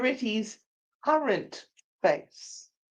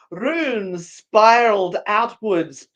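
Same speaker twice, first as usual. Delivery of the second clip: put-on slight Irish accent; 7 dB louder, slower than usual. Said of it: "You went a little Irish there".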